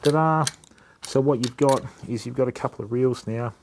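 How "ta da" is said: proud, louder, higher flourish; ta-da reveal. Real, not acted. Showing viewers the restored reels, a proud ta-da. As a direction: show off the finished reels.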